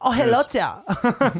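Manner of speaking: put-on slow, hammy German accent; German villain. Villain turning round to greet a visitor, German accent. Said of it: "to get into character for that".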